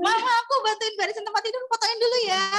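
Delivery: put-on high, fast eager little kid; child voice. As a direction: chirp it like a child showing off. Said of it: "Nanti minta".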